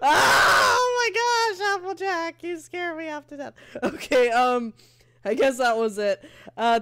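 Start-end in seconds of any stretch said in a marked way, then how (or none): 0.0-3.5 dramatic fright. Pretend. Gamer scolds Applejack for scaring them, high cry fading. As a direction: cry out, then let it die away.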